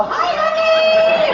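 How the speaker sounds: high, stretched hello to a lady; sing-song call, high and drawn out. Acted. Greets woman in front row.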